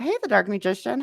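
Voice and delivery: ordinary talk, nothing marked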